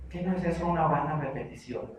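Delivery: ordinary talk, nothing marked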